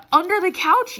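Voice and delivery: can't believe where it was; incredulous question. Real, not acted. Telling viewers where the dog pooped, loud incredulous rise. Disbelief at the poop's location.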